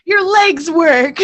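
relieved mother. Retold or imitated. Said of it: "She's like".

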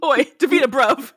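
put-on playful Essex lad accent; mock Essex accent. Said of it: "He's from Essex".

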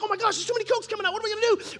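put-on high, fast, panicky teen voice; playing his young self freaking out; mock panic. Teen boys panic as too many Cokes pour out, fast. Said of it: "We're like".

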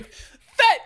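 high screamed single word, laughing; mock choir scream. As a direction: shriek it like a boys' choir. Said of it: "the boys going".

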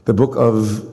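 ordinary talk, nothing marked

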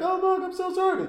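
mock-frantic apology to the neighbour at the door; mock panicked plea. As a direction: beg the neighbour in a hammed-up high voice. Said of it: "And you're like".

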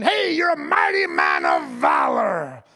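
slow, loud herald's shout; booming angel. Acted. Angel hails Gideon by surprise, slow booming proclamation. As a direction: boom it out like a heavenly announcement. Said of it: "the angel says to Gideon".

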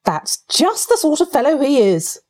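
high, mildly let-down remark; disappointed. A narrator's reading. Rat grumbling about badger's leaving, mildly disappointed. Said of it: "observed the disappointed rat".

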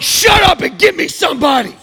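loud, high mock outburst; riled-up shout. Caller riled up at a phone computer, shouting in exasperation. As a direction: yell it at an automated phone system. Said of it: "I get so riled up".

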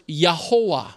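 Yahovah said as yeh-ho-AH; Yahovah without a v. Shown as a demonstration. Preacher offering the last possible reading of God's name, Yahovah said yeh-ho-ah. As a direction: say Yahovah with "ho-ah" at the end, falling. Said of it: "if it's pronounced".

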